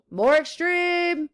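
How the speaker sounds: intense. Shown as a demonstration. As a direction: make "extreme" sound extreme, drawn out high. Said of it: "makes a pronoun more intense".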